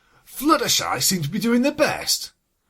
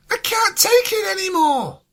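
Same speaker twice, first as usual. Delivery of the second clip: high, loud burst of despair; yelled; 10 semitones higher, 4 dB louder than usual. Acted. Pinky breaking the silent game, yelling. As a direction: crack under the silence and yell. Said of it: "she yelled".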